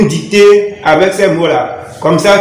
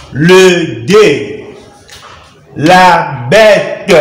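very slow, drawn-out reading lesson delivery; syllable by syllable; much slower than usual. Shown as a demonstration. Teacher training learners to read words, slowly syllabified. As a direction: read it out with each syllable stretched and separate.

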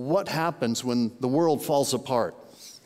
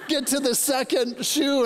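ordinary talk, nothing marked

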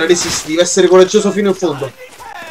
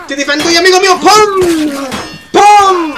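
heroic battle cry; 9 semitones higher than usual. Real, not acted. Gamer rushing to defend his friend, battle cry. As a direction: call it high, punch out each hit.

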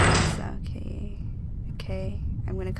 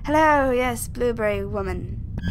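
ordinary talk, nothing marked

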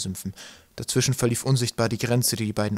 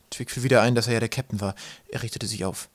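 ordinary delivery

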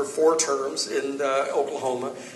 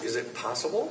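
very soft, low question; hushed disbelief; 4 semitones lower, 10 dB quieter than usual. Retold or imitated. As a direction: ask it quietly, as a confidential aside. Jerry comes over to confide.

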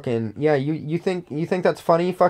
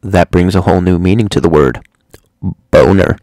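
loud, much lower booming voice; deep mock announcer; 8 semitones lower, 10 dB louder than usual. Punchline about bones in a booming voice. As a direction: deliver the pun like a big announcer.

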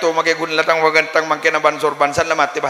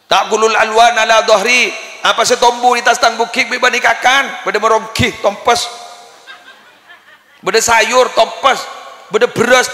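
raised, loud sermon voice from the pulpit; preaching at full force; 7 semitones higher, 5 dB louder than usual. Acted. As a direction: drive the sermon home, high and loud. Preaches a long passage. (berkhotbah dengan suara lantang dan tinggi)

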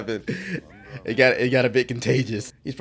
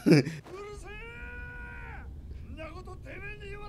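a long, high delighted squeal of laughter; 8 dB quieter than usual. Real, not acted. Viewer reacting to the fight, squealing high with laughter.

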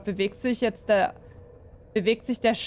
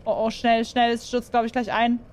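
normal talking voice

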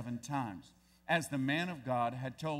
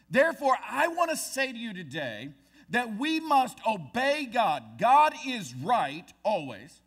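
louder, higher, driving the point home; preaching at full force; 6 semitones higher, 7 dB louder than usual. Real, not acted. Pastor exhorting the congregation to obey God, louder.

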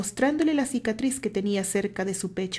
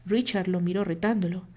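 usual speaking voice

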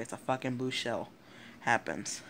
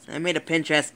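frustrated shout; 5 semitones higher, 11 dB louder than usual. Real, not acted. Frustrated at a broken link.

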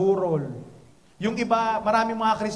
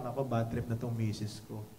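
low, soft muttered complaint; wife's gloomy thought; 11 semitones lower, 7 dB quieter than usual. Retold or imitated. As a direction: lower and softer, fed-up. Voicing his upset wife's thought.

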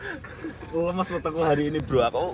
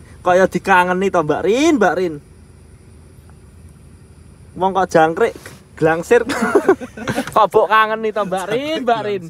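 teasing; 4 semitones higher, 4 dB louder than usual. Real, not acted. Teasing calls to Mbak Rin.

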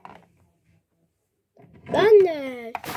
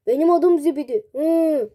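mock wail; 6 dB louder than usual. Offended, crying out.

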